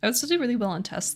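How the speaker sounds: ordinary talk, nothing marked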